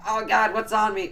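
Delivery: high, loud retold cry of alarm; hammed-up panicked outcry; mock panic. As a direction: play up the horrified disgust. Acting out panic after ghost contact.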